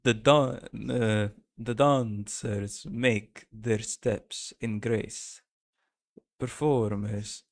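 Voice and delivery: slow Russian accent, meant to be German; heavy, halting Russian accent. Shown as a demonstration. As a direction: attempt German and land in Russian, drawing out each word. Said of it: "me doing a Russian accent".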